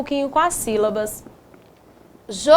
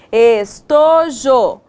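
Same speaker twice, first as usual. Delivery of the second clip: drawn-out right word, pleased; slow syllable-by-syllable try; 3 dB louder, much slower than usual. Shown as a demonstration. Teacher to second graders, slowly sounding out the right word. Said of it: "Isso mesmo".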